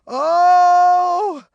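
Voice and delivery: loud, high, sustained "oh"; shouted wail. A narrator's reading. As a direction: wail it out at full voice. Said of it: "Ruby shouted".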